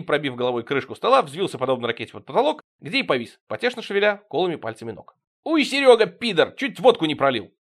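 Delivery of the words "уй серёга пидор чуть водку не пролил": loud, high outraged yell; indignant holler. A narrator's reading. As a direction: shout it like an angry group. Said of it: "Хором завопили мы".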